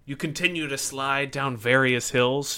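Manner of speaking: usual speaking voice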